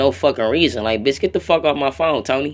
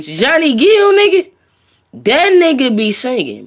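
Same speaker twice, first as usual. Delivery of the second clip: much higher and louder, slowed; excited praise; 8 semitones higher, 6 dB louder than usual. Real, not acted. Vlogger praising the singer, excited high shout. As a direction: rave about him in a high loud voice.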